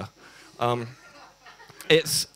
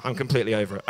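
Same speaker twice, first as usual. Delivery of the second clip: usual speaking voice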